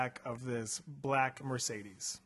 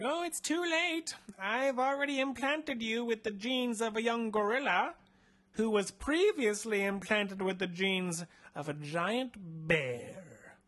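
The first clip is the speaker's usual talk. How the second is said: higher put-on mad scientist voice; creepy doctor; 7 semitones higher than usual. Doctor Nair asking the captive about genetic engineering, higher sinister voice. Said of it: "He's like".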